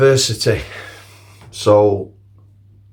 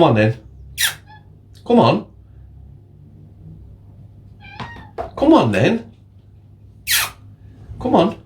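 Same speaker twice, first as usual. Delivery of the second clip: high sing-song call to a cat; 11 semitones higher than usual. Real, not acted. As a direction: coax the cat over in a much higher voice. Calling the cat over.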